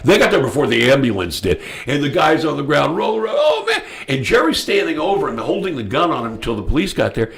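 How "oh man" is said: very high imitation of an injured man's moan; hammed-up falsetto wail with amusement; mock wailing. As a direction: imitate a man writhing, pitched very high. Shot man rolling on ground, acted.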